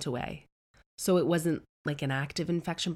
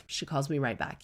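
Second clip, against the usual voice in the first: usual speaking voice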